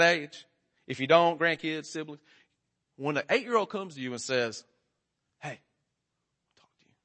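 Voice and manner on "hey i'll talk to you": slow, whispered summons; child's secretive warning. Retold or imitated. Eight-year-old comes to you to talk, whispered warning. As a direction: whisper it slowly, like a little boss. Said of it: "that's the way she talks".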